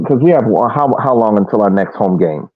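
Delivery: ordinary talk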